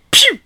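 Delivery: high, loud "pew" noise; imitated gunshot. Co-host imitates the tripwire shot going off, high zap. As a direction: mimic a toy gun firing.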